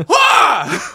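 loud, high-pitched excited scream. Retold or imitated. Fan reenacting the comic's ending, screaming with excitement. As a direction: scream out at full pitch, thrilled. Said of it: "literally yelling out loud with excitement".

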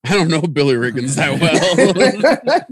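laughing through the quote; cracking up mid-line. Retold or imitated. Quoting Coach Taylor, cracking up.

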